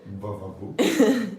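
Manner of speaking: slow, loud stretched exclamation; a loud, drawn-out cheer; loud va-va-voom. Real, not acted. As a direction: belt it out slowly like a showman. Exclaims at dog.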